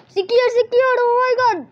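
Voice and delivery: loud, high-pitched alarm; high, urgent gamer panic; panicked cry. Real, not acted. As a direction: shout it in a panic. Panics during the game.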